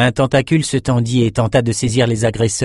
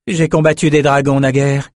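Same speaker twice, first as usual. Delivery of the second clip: confident announcement; 4 semitones higher, 4 dB louder than usual. Acted. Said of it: "annonça Nietzsche".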